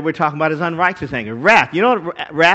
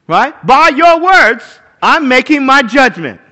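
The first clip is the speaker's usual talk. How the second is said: Judge Judy verdict; 7 semitones higher, 10 dB louder, slower than usual. Retold or imitated. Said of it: "she goes".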